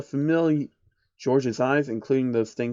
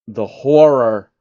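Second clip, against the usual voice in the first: slow, louder mock-dramatic lament; mock horror; 7 dB louder, much slower than usual. Narrator mocking a peasant's town, hammed-up horror. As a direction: ham up the dread.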